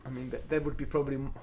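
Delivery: normal talking voice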